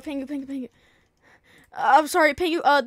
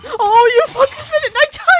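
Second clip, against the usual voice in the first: high loud indignant yell; outraged shout; 11 semitones higher, 11 dB louder than usual. Real, not acted.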